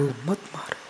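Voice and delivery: मत मारो dropped to a near hush; skull pleading softly. Acted. Skull in the cremation ground begging a walker, hushed. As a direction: let मत मारो fade almost to nothing. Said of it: "खोपड़ी बोल उठी".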